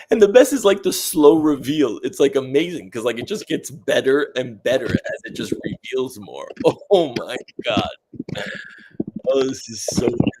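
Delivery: high, loud delighted rave; excited gushing. Real, not acted. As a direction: gush over the reveal with joy. Thrilled at her own drawing.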